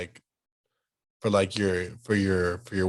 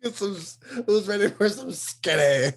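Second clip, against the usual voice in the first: mock host; 9 semitones higher than usual. Teasing buffet announcement.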